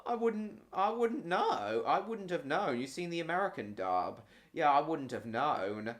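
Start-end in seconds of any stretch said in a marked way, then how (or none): 0.0-2.8 mock innocence.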